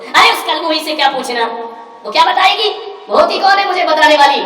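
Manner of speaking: loud, high contempt for the mother-in-law; loud, sneering daughter; scornful outburst. Retold or imitated. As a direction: spit out the insult at her. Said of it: "उसने कहा".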